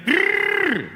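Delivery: high, strangled growl; imitated growl. Woman on the subway growling, high imitated growl. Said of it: "I hear it again".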